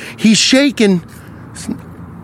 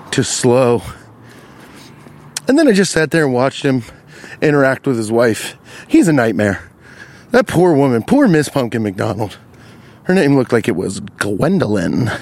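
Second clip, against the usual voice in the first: normal talking voice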